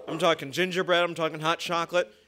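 ordinary talk

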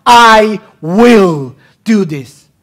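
slow, loud, high voice of God; God's promise. Acted. Preacher voicing God's vow to Abram, loud and slow. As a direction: proclaim it slowly as God.

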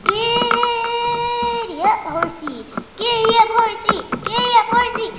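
high, playful chant to a horse; sing-song giddy-up. Acted. Rider keeps urging bunny, high playful chant.

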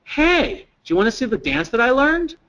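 put-on high, eager little-kid voice; four-year-old girl. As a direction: lightly act a busy child showing off. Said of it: "says to the receptionist".

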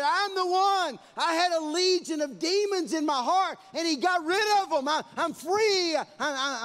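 loud, high, joyful cry of deliverance; freed man's testimony. Acted. The freed demoniac telling everyone he's free, loud and joyful. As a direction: shout it like a man set free.

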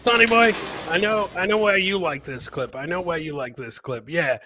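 ordinary talk

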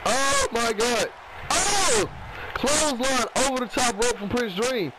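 lively ringside play-by-play; wrestling commentator. Acted. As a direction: call the match as it happens. Live play-by-play of the match.

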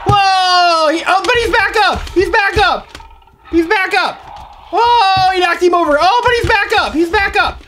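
high, animated play-by-play; excited fight commentary. Pretend. Following the dinosaur's battle with Kion, excited commentary. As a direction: react with amazement to every comeback.

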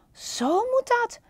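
high, eager cry; excited call. Acted. Panda watching the dancing flamingos, high and excited. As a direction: it clicks, call it out. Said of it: "riep Panda".

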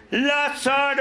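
high, drawn-out call; calling shout. Acted. Jesus calling to buried Lazarus, drawn-out shout. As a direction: holler into a cave. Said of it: "le grita".

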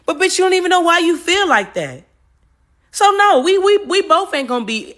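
high, loud, exasperated outburst; indignant and loud, voice pushed right up; heated venting. Real, not acted. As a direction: pitch climbs as you tell them off. Venting at someone projecting onto her.

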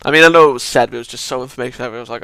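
normal talking voice

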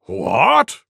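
tiger's loud, rising what; roared. Acted. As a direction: roar it in shocked disbelief. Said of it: "roared Tiger".

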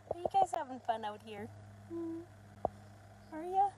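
put-on high, sing-song baby talk to puppies; talking to the puppies in a high cooing voice; puppy talk. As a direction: coo at the puppies like they're toddlers.

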